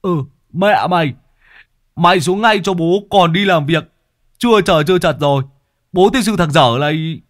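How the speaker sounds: loud, high cursing tirade; furious scolding. A narrator's reading. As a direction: chew him out till hoarse. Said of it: "mắng đến rát cổ".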